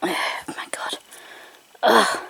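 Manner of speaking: sigh, then whisper it in frustration; exasperated whisper. Real, not acted. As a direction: breathe it out, fed up. Frustrated with the spinner.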